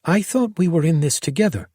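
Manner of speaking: hurt accusation. A narrator's reading. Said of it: "Lacey demanded".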